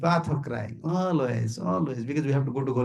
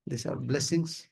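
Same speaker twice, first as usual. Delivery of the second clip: ordinary talk, nothing marked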